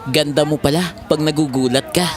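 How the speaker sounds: ordinary talk, nothing marked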